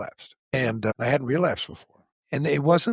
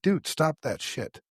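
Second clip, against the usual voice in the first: firm friendly warning; slower than usual. Retold or imitated.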